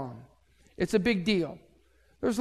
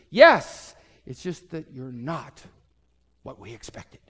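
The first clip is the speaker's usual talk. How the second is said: strained politeness; 5 semitones lower, 3 dB louder than usual. Retold or imitated.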